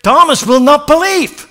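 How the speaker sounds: high, loud preaching build; fiery preaching. Acted. Preacher driving the story home to the congregation, fiery. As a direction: preach it out to the church.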